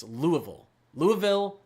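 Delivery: Louisville in three syllables, LOO-uh-vill; middle vowel kept. Shown as a demonstration. Streamer correcting chat on the name, middle vowel kept. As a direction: put a small "eh" inside Louisville. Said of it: "there's like a eh in there".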